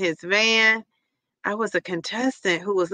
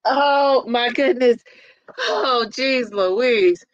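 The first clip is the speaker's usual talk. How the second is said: laughing; much slower than usual. Real, not acted. Laughing hard at the comments.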